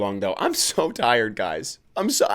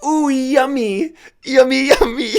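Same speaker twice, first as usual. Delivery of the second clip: much higher, louder, slow squealing glee; childish chef delight; 12 semitones higher, 7 dB louder, slower than usual. Pretend. Podcaster imagining a chef tasting a dish he loves, squealing. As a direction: coo like a delighted toddler over the plate. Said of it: "he gets a dish he really likes".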